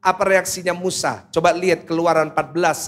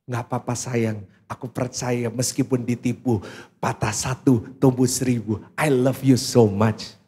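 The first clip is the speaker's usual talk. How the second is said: sweet supportive wife; 7 semitones lower than usual. Acted. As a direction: coo it like a loving wife, slowing on the English.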